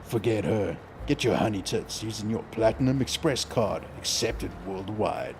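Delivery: low radio ad voice, slowing on the tagline; mock radio voice. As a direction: pitch it like a radio commercial announcer. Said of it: "it's kinda like my radio voice".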